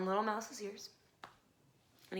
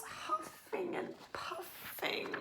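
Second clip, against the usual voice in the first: slow eerie whisper; spooky whisper; 12 semitones higher, 4 dB quieter, much slower than usual. A narrator's reading. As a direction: breathe it out slowly like the wind. Scary wind sounds, whispered.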